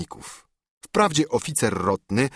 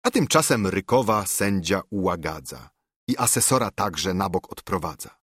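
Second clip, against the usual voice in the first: usual speaking voice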